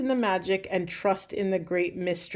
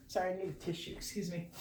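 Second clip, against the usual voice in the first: much softer, choked-up apology through tears; teary aside; 13 dB quieter, much faster than usual. Real, not acted. Reader tearing up mid-message, reaching for a tissue, softly teary. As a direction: pull away from the mic and dab your eyes. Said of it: "I'm getting emotional".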